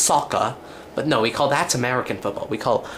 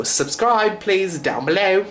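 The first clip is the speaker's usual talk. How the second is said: pitched way up, slowed plea; high slow subscribe plea; eager plea; 7 semitones higher, slower than usual. Real, not acted. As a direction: beg them to subscribe, bright and high.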